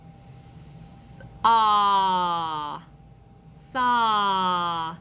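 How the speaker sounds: "S-" 속삭이고 "ah" 길게 끈 뒤 "saw"; slow sound check. Shown as a demonstration. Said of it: "do a slow check together".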